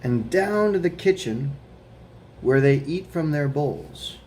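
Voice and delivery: put-on slow, careful read-aloud for children; reading a picture book aloud. A narrator's reading. Teacher reading The Paperboy to her students, slow storytime voice. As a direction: read it slowly to a class of kids.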